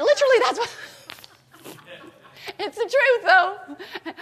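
high laughing aside. Real, not acted. Laughing to the congregation that it's the truth. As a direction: laugh it off while insisting.

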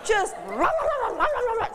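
high, sharp "rah, rah" barks, laughing; imitated dog barking. Imitating the dog that went off on her, high barks. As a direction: bark "rah" like an angry dog. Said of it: "the dog just went off on her".

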